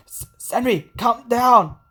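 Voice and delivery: stuttering, urgent call of her name; urgent shout. Acted. John shouts at panicking Senri.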